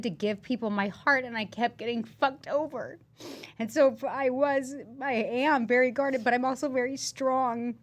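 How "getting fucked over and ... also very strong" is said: holding back tears while admitting it; tearful. Real, not acted. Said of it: "got me to cry".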